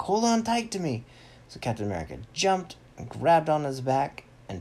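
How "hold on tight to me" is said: high, loud acted hero call; Turn Ninja. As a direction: call out a warning, pitched up. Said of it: "He's like".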